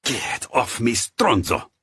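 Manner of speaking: snarl. Acted. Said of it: "Giulio snarled".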